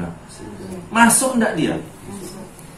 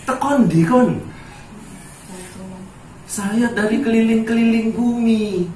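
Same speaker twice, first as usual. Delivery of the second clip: voiced devil, pitched higher; lightly voiced Satan reporting back; devil answering; 5 semitones higher than usual. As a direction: play the devil replying casually to God. Devil answering God in story.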